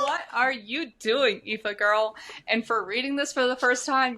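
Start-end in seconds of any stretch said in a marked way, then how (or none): 0.0-2.2 teasing. Real, not acted. Playful teasing exclamation.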